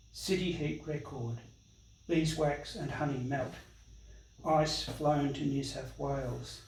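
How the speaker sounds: measured, declamatory public reading of news reports; formal literary recital with deliberate pauses; documentary reading. Acted. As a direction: read it out like a solemn chronicle, phrase by phrase. Reads aloud a documentary text on bushfires.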